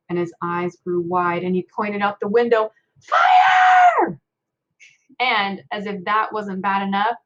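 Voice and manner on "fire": high frantic yell of alarm; shouting fire. Acted. Uncle spotting flames in the window, screaming in alarm. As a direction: scream the warning at the top of your voice. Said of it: "he hollered".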